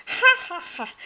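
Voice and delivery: shrieking mock guffaw; high loud guffaw. A narrator's reading. Hildy laughs at Cinder Hazel, high loud guffaw. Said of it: "she guffawed".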